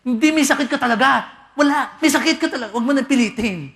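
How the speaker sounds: high, loud pushing back; insistent shout. Retold or imitated. Visitor loudly insisting the patient is sick. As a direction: hammer it home at the top of your voice.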